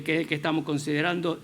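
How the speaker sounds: ordinary talk, nothing marked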